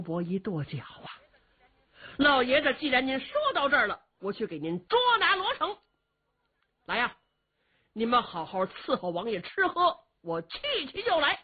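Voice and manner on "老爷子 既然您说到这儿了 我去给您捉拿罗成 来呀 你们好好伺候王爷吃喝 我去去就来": resolute outburst. Acted. 东方伯 stamps, agrees to capture.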